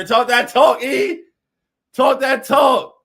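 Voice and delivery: hype shout. Real, not acted. Streamer hyping up a chatter named E, loud hype shout. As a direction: cheer him on like a hype man.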